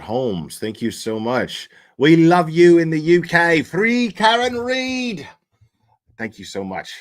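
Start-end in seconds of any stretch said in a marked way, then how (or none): 2.0-3.6 high, loud mock British accent; loud hammed-up British voice. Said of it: "I'm terrible at that accent". 3.6-5.3 high, slow mock British accent; slow, high hammed-up British voice. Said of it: "I'm terrible at that accent".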